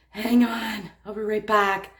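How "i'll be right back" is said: ordinary delivery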